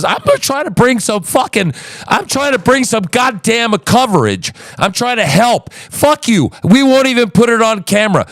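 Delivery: high-pitched, indignant protest; exasperated outburst. Real, not acted. Host defends his offer of coverage, exasperated.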